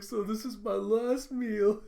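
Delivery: high, slow, loud mock wail; mock despair. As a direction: lament it like a doomed man, hammed-up. Mock doom before tasting the food.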